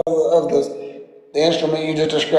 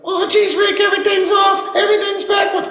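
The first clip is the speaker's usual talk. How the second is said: very high shouted panic; squeaky frantic teen yelling; Morty voice. Retold or imitated.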